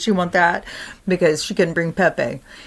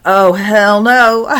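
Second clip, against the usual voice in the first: drawn-out, loud, disgusted grandpa; loud slow hell no; 4 semitones higher, 8 dB louder, slower than usual. Retold or imitated. Retelling grandpa catching the ghost in his car, drawn-out loud refusal. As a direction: stretch it out loud and fed up.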